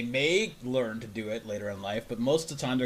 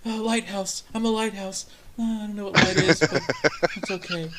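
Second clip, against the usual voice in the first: higher, proud sing-song voice; higher proud childlike boast; proud naive attendee; 7 semitones higher, 3 dB louder than usual. Retold or imitated. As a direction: announce it like a beaming child. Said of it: "my mama would be so proud".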